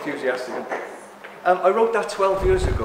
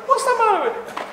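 puzzled; 6 dB louder, faster than usual. Retold or imitated. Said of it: "I went".

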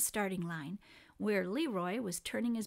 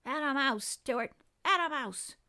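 mildly raised crowd cheer; light, higher-pitched spectator cheer; 4 semitones higher, 4 dB louder than usual. A narrator's reading. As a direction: call out encouragement to the hero. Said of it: "people cheering for him and calling".

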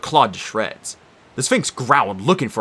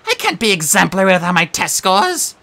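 whining; 7 semitones higher, 5 dB louder than usual. Acted. Sphinx laments.

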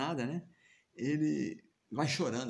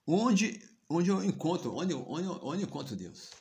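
put-on eager child's question; 6 semitones higher, 5 dB louder than usual. Five-year-old boy asks mother.

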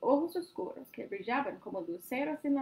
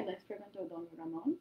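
ordinary delivery

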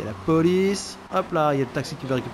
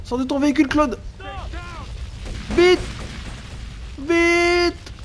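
urgent order; 13 semitones higher, slower than usual. Real, not acted. Player ordering Claude out of the car, high urgent shout. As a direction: hurry him out, pitch up.

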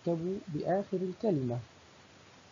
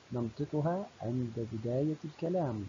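ordinary talk

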